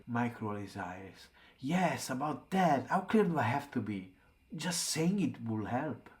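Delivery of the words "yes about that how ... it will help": loud, higher-pitched exasperation; exasperated. A narrator's reading. Mike rolling his eyes at Will's question, loudly exasperated. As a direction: burst out as if it's painfully obvious.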